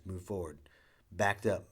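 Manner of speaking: ordinary delivery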